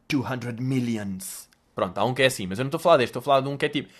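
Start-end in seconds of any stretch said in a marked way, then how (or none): 0.1-1.5 mock American dealer. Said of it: "E o gajo tipo".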